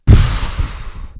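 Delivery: loud exasperated blow of air; grumbling puff. A narrator's reading. Ménie straightening his aching back, loud grumbling puff. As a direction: blow out hard in weary annoyance. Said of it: "maugréa-t-il en se redressant".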